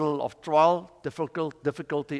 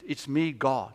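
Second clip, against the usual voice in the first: lower, softer reply after the shouting; calm divine answer; 5 semitones lower, 6 dB quieter than usual. Retold or imitated. God answers the man on the tree, calmly. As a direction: answer low and unhurried.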